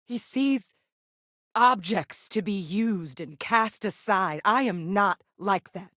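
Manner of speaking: slow, emphatic condemnation; pained, deliberate disavowal with weighted pauses; bitter indictment. Acted. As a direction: spell out the grievance slowly, word by word. Acted bitter disavowal of a relative.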